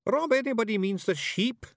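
high Scots pixie voice; correcting Feegle. A narrator's reading. Awfully Wee Billy corrects Rob in a high Scots voice. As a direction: put it right gently.

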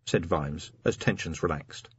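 usual speaking voice

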